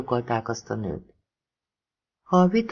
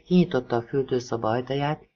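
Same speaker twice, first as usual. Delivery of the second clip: ordinary delivery, nothing marked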